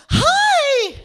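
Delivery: very high, loud gushing hi; excited hi. Retold or imitated. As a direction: squeal it like a starstruck fan. Excited greeting to her idol.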